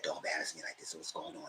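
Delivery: whispered warning at the party; hushed whisper. Retold or imitated. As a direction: hiss it so nobody hears. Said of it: "He said".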